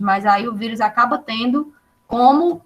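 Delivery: ordinary delivery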